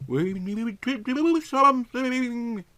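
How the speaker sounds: usual speaking voice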